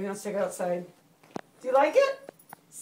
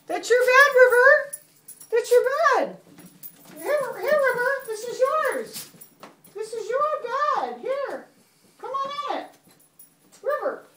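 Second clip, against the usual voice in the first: high-pitched singsong to a pet; coaxing pet talk; 8 semitones higher, 5 dB louder than usual. Real, not acted. Mommy showing River the new bed, coaxing pet talk. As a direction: coax the animal over in a high, lilting voice.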